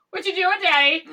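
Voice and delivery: put-on loud, high-pitched little-kid pipe-up; mock oblivious child, blurting it out loudly; imitating Amelia. Daughter interrupting dad while hiding in the woods, loud childish voice. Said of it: "And Amelia would just be like".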